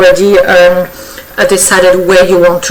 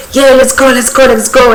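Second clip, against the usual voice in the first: higher-pitched eager puppy energy; excited; 4 semitones higher than usual. Acted. Tarot reader voicing an over-excited client, excited and higher. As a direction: act out someone raring to go. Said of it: "you're very excited, like".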